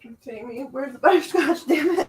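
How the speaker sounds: talking through hiccups; words broken by hiccups. Real, not acted. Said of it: "now I've got the hiccups".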